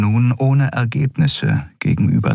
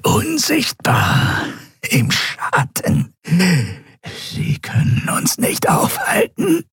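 slow, high, strangled nasal rasp; pressed creature croak, almost voiceless; choked reptilian croak; 11 semitones higher, slower than usual. A narrator's reading. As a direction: squeeze the words out, drawn out and croaking. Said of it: "krächzte die gepresste, nasale Stimme des Draxyl".

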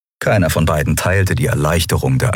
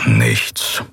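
much lower, gruff "Nichts" sinking in disappointment; disappointed grumble; 8 semitones lower than usual. Acted. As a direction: grumble "Nichts" deep and let down. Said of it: "brummte Keru hörbar enttäuscht". (tiefes, enttäuschtes Brummen bei „Nichts“)

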